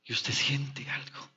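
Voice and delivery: whispered and slow, full of dread. Acted. Preacher telling the sinner what she feels, slow dread whisper. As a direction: whisper it as if the fear just arrived.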